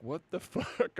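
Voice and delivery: soft, laughing what the fuck; laughing disbelief. Retold or imitated. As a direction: giggle through the shocked question. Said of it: "looks at me like".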